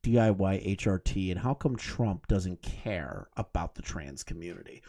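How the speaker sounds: low, soft mock-serious question; understated deadpan date voice; imagined date's question. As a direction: pose it quietly like an awkward date. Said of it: "pick their head up and go".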